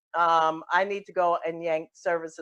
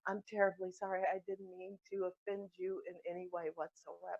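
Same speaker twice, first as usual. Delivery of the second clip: much softer, contrite; subdued apology; 14 dB quieter than usual. Acted. Caller apologises to offended counselor, subdued. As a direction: back down quietly.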